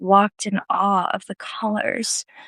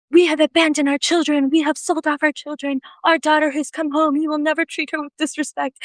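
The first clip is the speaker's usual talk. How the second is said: high-pitched, emotional retold proclamation; impassioned defence; 7 semitones higher than usual. Two Korean mommies defend their returned daughter, impassioned. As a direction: voice the protective mothers, pitched up and insistent.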